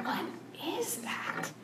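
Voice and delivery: hushed, whispered question; barely voiced, wary whisper; squinting, uncertain. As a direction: whisper it as if peering into the dark. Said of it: "they're kinda squinting, like".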